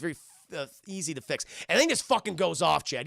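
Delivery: ordinary delivery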